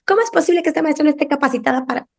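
mock outrage at the teacher, rushed and high; indignant complaint. Parent complaining to school about teacher, indignant fast rant. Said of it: "los papás llegan muchas veces con quejas".

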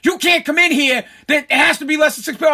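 loud, high, rapid-fire telling-off; imitating someone yelling at him; retold yelling. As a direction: yell it like an official scolding a customer. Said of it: "I get yelled at".

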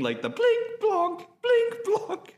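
high squeaky blink blonk; cartoon blink sound. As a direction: mimic cartoon blinking noises.

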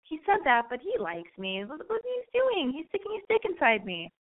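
mock-naive girl defending him. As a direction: gush like a smitten girl ignoring the signs.

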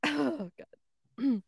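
usual speaking voice

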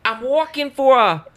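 high, loud New York accent attempt; hammed-up New York accent; bad New York accent. Shown as a demonstration. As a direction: shout it in overdone New York. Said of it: "Try a New York accent".

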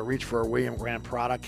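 usual speaking voice

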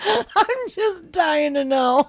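high, drawn-out words through laughter; laughing. Real, not acted. Teasing co-host over the Speedo question, laughing. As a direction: squeal it out slowly while cracking up.

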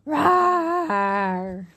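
high, loud roaring cry; loud dinosaur roar. Pretend. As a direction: roar like a T-Rex. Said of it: "I do sound effects".

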